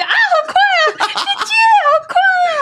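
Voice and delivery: 失控尖叫笑喊; laughing scream. Retold or imitated. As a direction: scream while rolling downhill. Kid losing control downhill.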